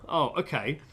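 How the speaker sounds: ordinary talk, nothing marked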